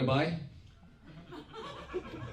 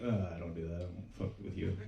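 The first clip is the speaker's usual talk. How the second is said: much lower and softer, mumbled refusal; low mumbled brush-off; 10 semitones lower, 11 dB quieter than usual. Retold or imitated. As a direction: mutter it down to the Girl Scout. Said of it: "And I was like".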